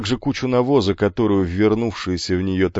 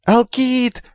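high, piercing yell across the yard; shrill screech; 4 dB louder than usual. Acted. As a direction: shriek for your big brother. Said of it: "пронзительно заверещал он на весь двор".

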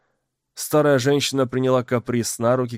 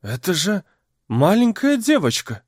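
surprised; 7 semitones higher than usual. Acted. Crowd voice gasping at girl.

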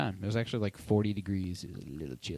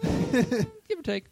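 drawn-out silly voice; much slower than usual. Pretend. Playful joke about few listeners.

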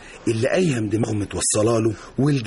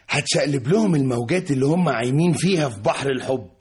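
ordinary talk

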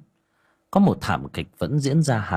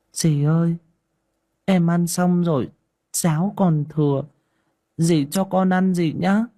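put-on high, meek child asking; timid little girl; 7 semitones higher than usual. Stepdaughter timidly asking for leftover porridge, meek. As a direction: ask shyly, small and higher. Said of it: "lấm lép".

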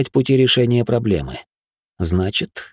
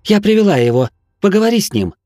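shouting over wind; 10 semitones higher, 4 dB louder, faster than usual. A narrator's reading. Calling out against wind.

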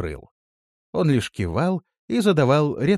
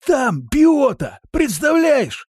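high, loud excited announcement; amazed; 6 dB louder, much slower than usual. A narrator's reading. Fellow telling Vardan who is in the well, amazed exclamation. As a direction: report it in sheer astonishment. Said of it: "сильно изумился жердяй".

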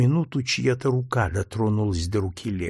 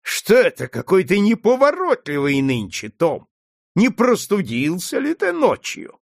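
high, louder sneering questions; mocking taunt; 8 semitones higher, 4 dB louder than usual. A narrator's reading. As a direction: jeer at him with fake concern. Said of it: "Легри злобно расхохотался".

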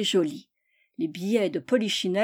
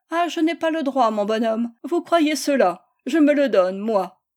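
higher, louder mock outrage; indignant retort; 4 semitones higher, 5 dB louder than usual. A narrator's reading. Madame de Montclers defies Tocambel over his parcel, indignant. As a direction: retort with acted defiance.

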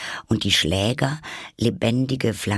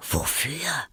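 soft, breath-only query; whispered question; 6 semitones higher, 5 dB quieter than usual. A narrator's reading. Alice asking the Rabbit about the sentence, whispering.

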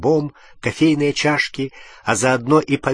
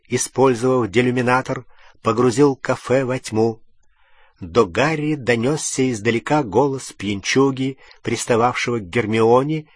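usual speaking voice

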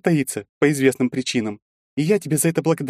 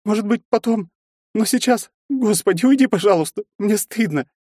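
high, louder, weeping plea; tearful pleading; 4 dB louder than usual. A narrator's reading. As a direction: beg him to leave through tears. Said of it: "и расплакалась".

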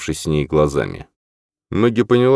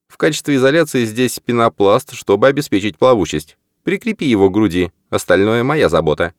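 raised, lighter male character voice; Norman voice; 6 semitones higher than usual. Acted. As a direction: voice Norman higher than the narrator. Norman's lines in the story.

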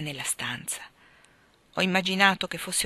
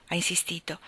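usual speaking voice

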